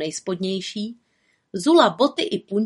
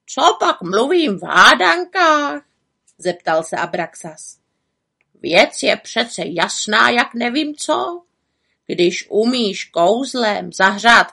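higher raven voice, exasperated and preachy; scolding raven; 4 semitones higher, 5 dB louder than usual. A narrator's reading. Raven scolds the witch for neglecting herself, exasperated. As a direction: lecture her like a sensible raven.

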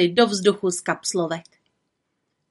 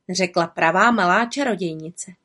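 ordinary delivery, nothing marked

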